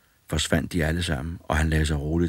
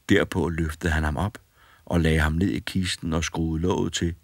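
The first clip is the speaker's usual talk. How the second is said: ordinary delivery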